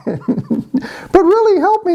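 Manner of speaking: very high falsetto plea; squeaky mock pleading from a little frog; fussing frog princess. Pocketed frog begs boy for help, high falsetto. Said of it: "putting up a fuss".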